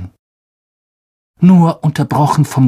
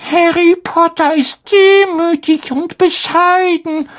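hohe, ehrfürchtige Elfenstimme; ehrfürchtig. Acted. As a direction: voller Ehrfurcht wie ein kleiner Hauself. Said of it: "sagte Dobby ehrfürchtig". (ehrfürchtige, sehr hohe Stimme des Hauselfen)

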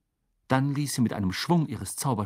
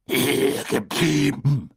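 slow, raspy roar, raised pitch; drawn-out growling bellow; Moody thundering; 4 semitones higher, 4 dB louder, much slower than usual. A narrator's reading. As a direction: thunder the order, dragging out each word. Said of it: "donnerte Moody".

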